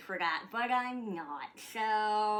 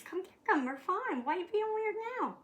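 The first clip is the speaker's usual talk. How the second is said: high coaxing; 9 semitones higher, faster than usual. Real, not acted.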